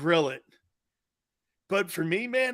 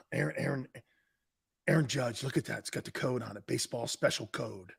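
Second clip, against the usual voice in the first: hushed; 5 semitones lower, 10 dB quieter than usual. Pretend. Hushed reverent ball presentation.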